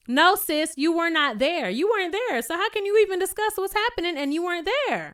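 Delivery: high, pushy mock argument voice; heated retort. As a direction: jab back hard at her. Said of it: "Courtney's like".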